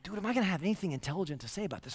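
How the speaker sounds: preacher recalling his turkey-coma panic, fast and soft; worried mutter. Retold or imitated. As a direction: rush the worried thought under the breath. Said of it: "I'm like".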